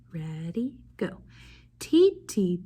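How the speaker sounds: ordinary talk, nothing marked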